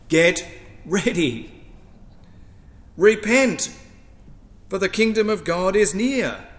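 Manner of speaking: loud, high, emphatic call to repent; prophet's proclamation. Acted. Quoting John the Baptist's call.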